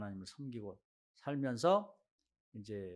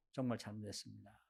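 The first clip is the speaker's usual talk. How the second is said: humble quiet confession; 6 semitones lower, 10 dB quieter than usual. Retold or imitated. Acted sincere confession.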